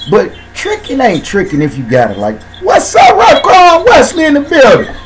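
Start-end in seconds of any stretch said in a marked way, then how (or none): 2.6-4.9 excited shout-out. Real, not acted. Shout-out to a listener.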